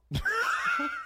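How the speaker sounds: squealed high laugh; 1 shrieking laugh. Real, not acted. Host hearing the new album name, shrieking laugh.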